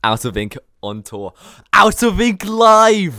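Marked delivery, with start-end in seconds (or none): 1.7-3.2 high, loud, slow arena announcer call; announcer shout. Pretend. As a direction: boom it out like announcing a live show. Hyping imagined live show.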